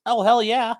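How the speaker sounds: ordinary delivery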